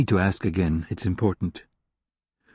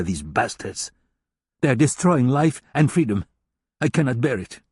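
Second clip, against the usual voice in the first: lightly voiced scornful German hacker, a touch higher; a hint of a contemptuous German hacker lecturing; 6 semitones higher, 3 dB louder than usual. German hacker despairs over cyberspace.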